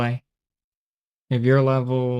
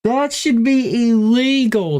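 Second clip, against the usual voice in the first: high, loud amazed outburst; excited; 11 semitones higher, 4 dB louder than usual. Real, not acted. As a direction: squeal it in disbelief. Amazed at huge reward.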